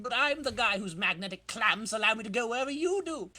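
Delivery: smug crook sneering at the webhead; taunting villain. Acted. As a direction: brag and threaten in a hammy voice. Villain taunts Spider-Man.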